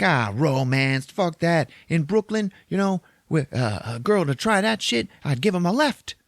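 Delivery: put-on high, scoffing New York accent; little New Yorker. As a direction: dismiss it with little-guy New York bluster. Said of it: "He's a fucking little New Yorker".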